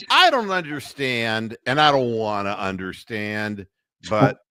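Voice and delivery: ordinary talk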